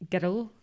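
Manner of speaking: put-on "girl" as two syllables, like "geddle"; Glasgow pronunciation. Shown as a demonstration. Demonstrating the best Scottish word, "girl" said "geddle". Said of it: "I think the best Scottish word".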